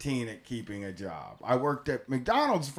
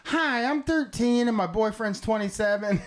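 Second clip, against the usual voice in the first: caller voice; 7 semitones higher than usual. Retold or imitated. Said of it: "and it's all like".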